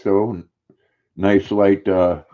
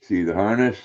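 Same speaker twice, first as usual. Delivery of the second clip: ordinary delivery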